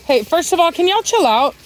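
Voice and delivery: high sharp call across the yard; yelling at dogs. Real, not acted. Owner yelling at her dogs to chill out, loud and high.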